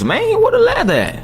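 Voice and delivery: squeaky high-pitched complaint; high squeaky outrage. Real, not acted. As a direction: squeal it in disbelief. Demanding lather, mock outrage.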